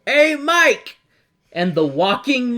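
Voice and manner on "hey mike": high loud call across the room. Retold or imitated. Guard calling the walking microphone over, high shout. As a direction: holler for Mike, high and loud. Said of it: "He says".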